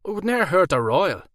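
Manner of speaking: high, loud thick Irish brogue; Irish werewolf. A narrator's reading. Werewolf explains his overbite to Alora in Irish accent.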